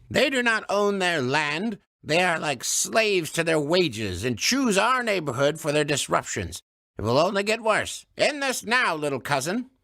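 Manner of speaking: stern elder woman; stern old woman. Acted. Missus Gudrun's voice.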